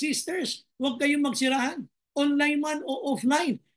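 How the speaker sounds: usual speaking voice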